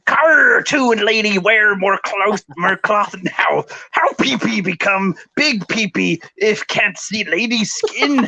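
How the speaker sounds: hammed-up dim caveman voice, broken English; different voice. Reading a commenter's post to stream viewers, mock caveman voice. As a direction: read the comment as a grunting, clueless troll. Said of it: "I need to do this in a different voice".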